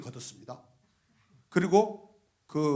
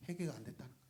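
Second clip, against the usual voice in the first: ordinary talk, nothing marked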